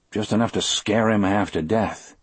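plain reading voice

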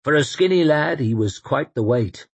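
plain reading voice

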